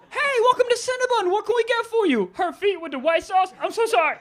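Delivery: high, chirpy put-on customer-service voice; server's greeting voice. Comedian mimics a Cinnabon counter exchange, high service voice.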